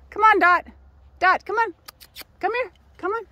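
high, loud call to a little dog. Real, not acted. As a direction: call her back up the trail, voice up high. Calling the dog on trail.

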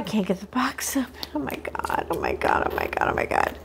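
breathy frantic whisper, fading; frustrated whisper. Real, not acted. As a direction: whisper it while struggling with the box. Struggling to open box.

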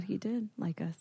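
soft whispered aside; whispering. Real, not acted. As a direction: whisper it in relief. Relieved aside.

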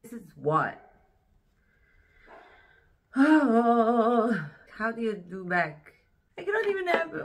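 ordinary delivery

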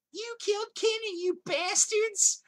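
put-on very high, loud, drawn-out child yell; kid's gleeful shout. A kid yelling the catchphrase at his mom's friend's office, very high and loud. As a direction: belt it out like a cheeky kid to a whole office. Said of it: "and I say".

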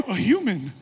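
huge, shrieky high gasp, then wonder; hammed-up comic amazement; awestruck angel. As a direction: gasp as if starstruck. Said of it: "the angels are in awe of us".

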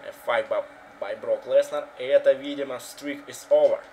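live wrestling play-by-play; wrestling commentator. Acted. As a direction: call the match live. (комментатор рестлинга)

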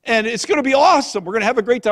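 loud, high, excited news; excited announcement. Retold or imitated. Son's letter home announcing a visit, excited. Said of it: "we were so excited when we got a letter".

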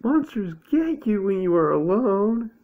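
high, loud frightened yell; panicked shout. A narrator's reading. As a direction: shout it high as a scared Owl. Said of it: "shouted Owl".

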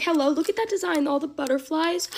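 ordinary talk, nothing marked

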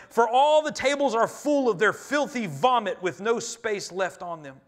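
preaching at full force. Acted. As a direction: thunder the verses out to the church. Reads scripture with force.

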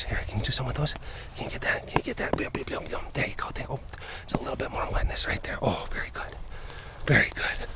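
hushed, breathy encouragement; soft whispered praise while painting the tiger; whispering. Real, not acted.

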